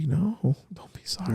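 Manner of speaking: hushed, soothing reassurance; whispering to a frightened girl that she needn't apologize; whispered comfort. Retold or imitated. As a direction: whisper it gently.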